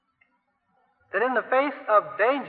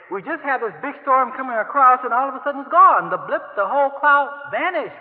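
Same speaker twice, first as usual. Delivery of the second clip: baffled forecaster; 5 semitones higher, 4 dB louder than usual. Pretend. Meteorologist's astonishment voiced.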